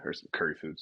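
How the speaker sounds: "or some curry food" as a soft, breathy whisper; whispered aside. Pretend. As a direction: drop to a whisper, as if catching yourself. Self-correcting curry to Indian food.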